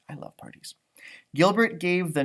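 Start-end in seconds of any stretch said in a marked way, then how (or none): 0.1-0.7 whispered aside. Real, not acted. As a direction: share a secret with the little listeners. Narrator's aside to children.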